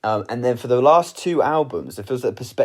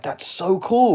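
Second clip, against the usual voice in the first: amazed; 8 semitones higher, slower than usual. Retold or imitated. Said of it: "And I'm like".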